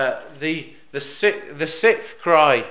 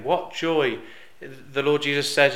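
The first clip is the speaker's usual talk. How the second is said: normal talking voice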